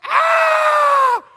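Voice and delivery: very high, loud mock scream; hammed-up girlish scream; imitated sister's scream. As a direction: shriek like a scared sister. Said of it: "She's like".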